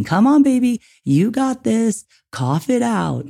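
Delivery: high, slow, sing-song encouragement; fake baby voice. Shown as a demonstration. Parent encouraging gagging baby, high slow cooing voice. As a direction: coo it out slowly to the baby. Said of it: "do the same thing when your baby's gagging".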